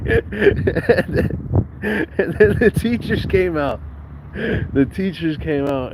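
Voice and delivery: high, laughing and slow; laughing while talking. Real, not acted. Telling about the playground fight, laughing high. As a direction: squeeze the words out through laughter.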